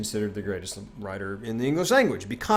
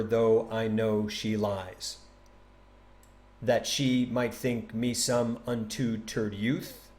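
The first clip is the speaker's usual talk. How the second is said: slow recital in weak-strong pairs, "when MY love SWEARS"; scanned iambs; much slower than usual. Shown as a demonstration. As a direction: count out each syllable, un-LEARN-ed style for "unlearned". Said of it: "an unstressed syllable followed by a stressed".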